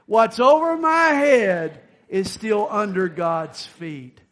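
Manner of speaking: shouted motto, said like you mean it. Shown as a demonstration. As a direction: belt it out with conviction. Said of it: "say it like you mean it".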